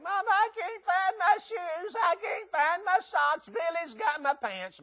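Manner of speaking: put-on high, slow childish whine; whiny kid voice. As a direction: whine like a kid who lost his shoes. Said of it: "the kids are yelling".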